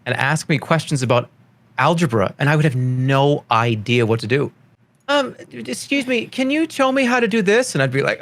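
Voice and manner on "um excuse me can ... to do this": high little student voice; imitated schoolchild, pitched way up; kid asking for help. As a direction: pipe up like a kid at the teacher's desk.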